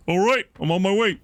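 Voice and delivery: a hint of a cartoon tiger; lightly voiced big cartoon cat; Tony the Tiger voice. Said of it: "That's his voice?".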